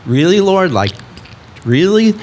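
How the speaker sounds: questioning the Lord, half joking; exasperated disbelief. Retold or imitated. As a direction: complain to God in mock exasperation. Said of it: "so I'm like".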